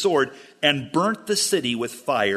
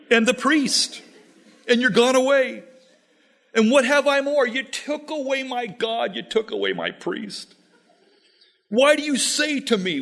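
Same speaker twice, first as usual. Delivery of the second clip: distressed lament; 8 semitones higher than usual. Acted. Micah laments his stolen gods.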